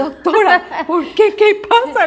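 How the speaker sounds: high, loud, half-laughing alarm; startled protest. Retold or imitated. To the examiner who threatened her, high startled protest. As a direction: cry out in shocked disbelief.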